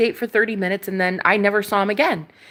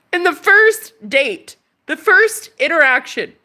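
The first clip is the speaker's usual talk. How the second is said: loud outraged; 7 semitones higher, 4 dB louder than usual. Real, not acted. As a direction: belt out the indignation. Outraged emphasis.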